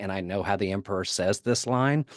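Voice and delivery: ordinary talk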